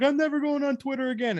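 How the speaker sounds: high-pitched teasing imitation; mocking a friend's vow. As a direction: mimic a dramatic quitter in a high voice. Teasingly quotes his friend's old vow.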